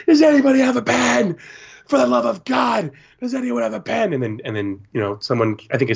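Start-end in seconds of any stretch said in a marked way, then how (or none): 0.1-4.1 put-on high-pitched frantic child; mock freak-out. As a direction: imitate a kid begging desperately for a pen. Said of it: "this kid's freaking out".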